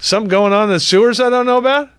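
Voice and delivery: quick, high, mock-astonished question; mock surprise. Real, not acted. Host teasing co-host about the sewers, quick mock surprise. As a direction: act shocked at news you doubt.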